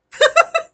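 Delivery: sudden high laugh; burst of laughter. Real, not acted. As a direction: break into a high squeal of laughter. Laughing at own joke.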